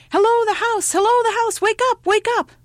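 high, loud singsong holler; calling out. A narrator's reading. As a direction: shout to rouse someone asleep indoors. Caller waking the sleeping woman.

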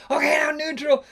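high, loud mock command; imitating the skipper from the bow; skipper's order. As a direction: call it out like the captain. Skipper calls gear change.

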